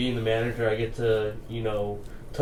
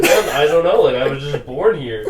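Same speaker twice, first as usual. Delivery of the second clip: laughing reply; 4 semitones higher, 10 dB louder than usual. Retold or imitated. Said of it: "and I was like".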